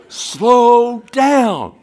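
high, loud, drawn-out imitated yell; exasperated mock shout. Friends repeating the answer to the test taker, loud mock shout. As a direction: yell the answer back, stretching both words. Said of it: "And, and they go".

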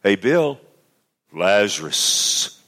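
God calling someone home, drawn out; slow, resonant call by name. Acted. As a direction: stretch the name out like a summons. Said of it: "when God calls you home".

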